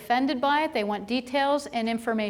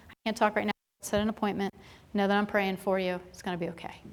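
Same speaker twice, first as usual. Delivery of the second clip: softer, a touch of rushed calm; mild mock of a quick dismissal; hurried brush-off; 4 semitones lower, 7 dB quieter, faster than usual. Staff rushing a scared patient off the phone, softer.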